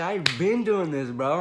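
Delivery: animated angry comeback retold; retold anger. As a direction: push back as if wronged, half in fun. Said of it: "I know I had that anger".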